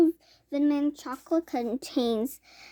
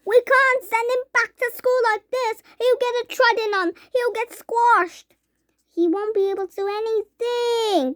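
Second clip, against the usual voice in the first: mother crying out in worry; anxious mother crying; 8 semitones higher than usual. A narrator's reading. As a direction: fret over the tiny son. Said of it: "cried Missus T V".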